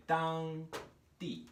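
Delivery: 当 high and level, pause, 地 falling; first-fourth tone pair. Shown as a demonstration. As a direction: hold 当 high, then drop 地. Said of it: "One more pair we're gonna talk about".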